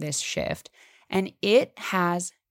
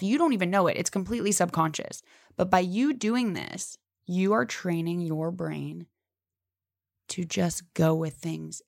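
usual speaking voice

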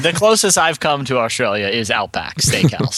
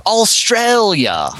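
slow, higher-pitched Australian accent; 7 semitones higher, much slower than usual. Shown as a demonstration. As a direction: draw the word out in an Australian accent. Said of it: "one word with an Australian accent".

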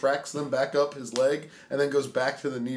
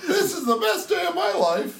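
fake wholesome; 7 semitones higher than usual. Mocking kid's wholesome praise.

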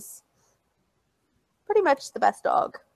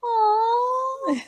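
cooing aw; 11 semitones higher, 4 dB quieter than usual. Retold or imitated. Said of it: "I'm like".

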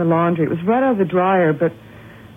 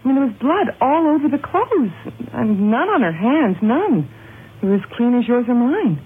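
anxious insistence; 6 semitones higher than usual. Acted. Frightened laundress insists on blood.

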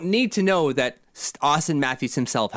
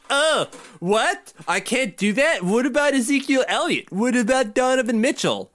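higher-pitched, put-on incredulous whining; mock indignant protest; 8 semitones higher than usual. Podcaster mocking a reporter who doubles down, indignant. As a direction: imitate someone indignantly doubling down. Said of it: "doubling down and being like".

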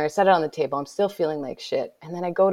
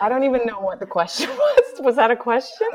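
giggling, higher and louder; laughing; 7 semitones higher, 4 dB louder than usual. Real, not acted. Laughing at forgetting the host's question. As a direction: laugh through the words.

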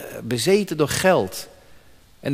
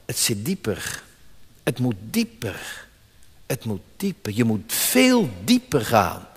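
Aslan speaking; 7 semitones lower, 6 dB quieter than usual. Acted.

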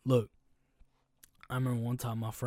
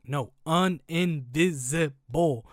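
"No, uninvisible" dragged out slowly and louder; slow insistent correction; 3 semitones higher, 7 dB louder, much slower than usual. Retold or imitated. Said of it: "I was like".

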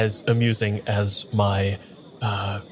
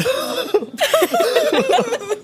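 squealing laugh; high burst of laughter; 4 dB louder than usual. Real, not acted. Breaks character laughing.

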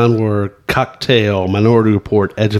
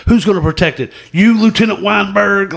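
high-pitched quoted challenge; mock courtroom challenge; 9 semitones higher than usual. Co-host quoting the witness's taunt, high mock challenge. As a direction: throw it out as an incredulous film-speech question. Acts out the film line.

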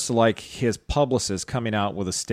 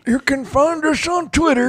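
put-on high old-timer voice; old guy voice; 14 semitones higher than usual. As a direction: talk like a doddering grandpa. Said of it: "I was waiting for the old guy voice".

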